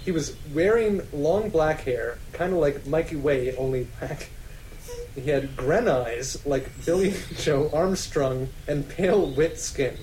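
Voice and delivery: ordinary talk, nothing marked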